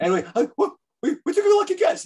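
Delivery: put-on flustered kid squeaking out an answer; squeaky pre-puberty voice. Young fan caught out by Gene, squeaking an excuse very high. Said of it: "my really high pre-puberty voice".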